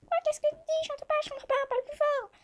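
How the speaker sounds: very high squeaky little ant; tiny ant voice. Acted. The ant answers Djibril, high-pitched and puzzled.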